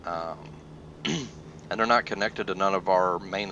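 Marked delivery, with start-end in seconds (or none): 0.4-1.0 quick throat clear. Real, not acted. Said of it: "Sorry about me clearing my throat".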